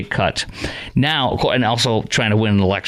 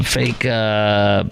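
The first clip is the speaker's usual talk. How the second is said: ordinary talk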